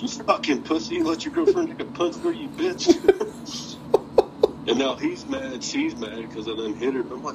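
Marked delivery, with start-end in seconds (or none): 0.0-3.8 much higher, laughing through the insult; laughing taunt. Retold or imitated. Wrestler taunting the fan who ducked, laughing high. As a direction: cackle the jeer out at the guy.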